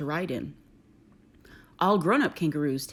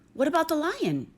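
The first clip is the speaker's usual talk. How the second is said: put-on much higher small child's question; little Freddie; 10 semitones higher than usual. Baby kangaroo suggesting the lion to his mother, high little voice. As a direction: ask like a tiny hopeful joey.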